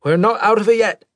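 high, loud pilot's call; urgent call. Acted. Pilot calls out amid flooding.

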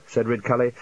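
plain reading voice

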